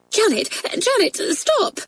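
high-pitched, anxious teacher's cry; alarmed cry. A narrator's reading. Teacher afraid the next class will hear, alarmed cry. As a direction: cry out to halt her, voice leaping high. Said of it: "cried Miss Kennedy, afraid".

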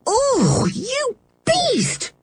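high, outraged cry; indignant. Acted. George to Dick who hurt her dog, indignant. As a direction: fling it at him in outrage. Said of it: "said George indignantly".

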